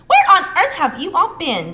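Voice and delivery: high, loud amazed; astonished portrait lady. A narrator's reading. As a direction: scold them in surprise. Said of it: "she asked".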